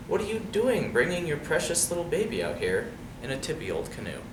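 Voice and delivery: usual speaking voice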